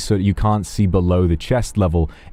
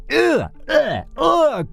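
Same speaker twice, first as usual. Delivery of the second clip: repeated disgusted groans, pitched high; disgusted ugh; much slower than usual. Real, not acted. Streamer recoiling at memes about older girlfriends, disgusted high ugh. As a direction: let out a squeaky, revolted ugh.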